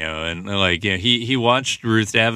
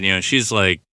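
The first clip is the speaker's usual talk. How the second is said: ordinary talk, nothing marked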